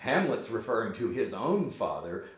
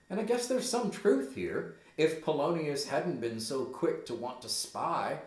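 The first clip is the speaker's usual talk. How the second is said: ordinary talk, nothing marked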